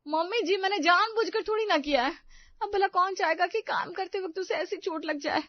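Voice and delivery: high, aggrieved, pleading voice; protesting daughter-in-law. Acted. Daughter-in-law answering her mother-in-law's blame, plaintively protesting. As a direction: defend yourself in a high, whining voice.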